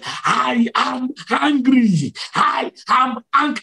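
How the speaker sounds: high, drawn-out angry declaration; angry divine voice. Retold or imitated. Preacher voices Jesus to a trembling believer, slow high anger. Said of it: "angrily saying that".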